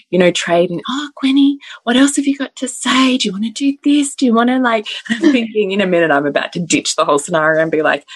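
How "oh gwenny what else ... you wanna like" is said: put-on higher sing-song coaxing a toddler; coaxing mums. Mums coaxing toddler off the microphone, higher sing-song. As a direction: sweet-talk the child, pitched up. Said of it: "all these mums trying to".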